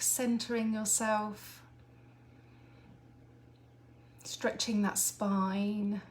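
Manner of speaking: slow, measured meditation guide; guided meditation. Acted. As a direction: ease them gently into calm, drawing words out. Leads a grounding visualisation.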